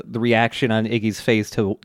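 ordinary talk, nothing marked

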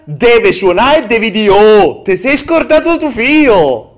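outraged rant. Real, not acted. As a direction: build up the outrage to a shout. Outraged build to alarm voice.

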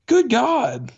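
a real fed-up groan of good God; exasperated outburst. Real, not acted. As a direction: throw up your hands in disgust. Ranting about autoplay ads.

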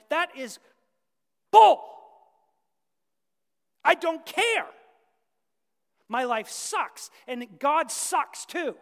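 furious, defiant yell at God; bitter outburst. Retold or imitated. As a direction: spit it out in angry despair. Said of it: "you went".

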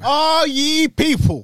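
high, slow, grand proclamation; town crier. Pretend. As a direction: call out like a herald to the crowd. Proclaiming like a herald.

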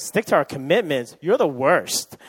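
loud, mock-outraged girlfriend; indignant echo. Girlfriend quarrels with boyfriend over birthday plans, indignant. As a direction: throw it back at him, loudly. Girlfriend throws his words back, upset.

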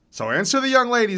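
high, loud, quick goading; reedy old man. A narrator's reading. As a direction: needle your rival in an accented voice. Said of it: "His voice is reedy but vigorous".

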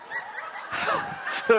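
high squeaky sucking sound of lips drawn in; mock toothless lip suck; lip-sucking noise. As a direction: imitate grandpa sucking his lips in. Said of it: "He'd go".

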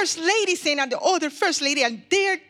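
high-pitched, alarmed news of a fight; frantic report. Acted. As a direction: report the emergency in a panic. Assistant reports a fight next door.

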